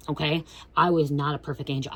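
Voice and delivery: ordinary talk, nothing marked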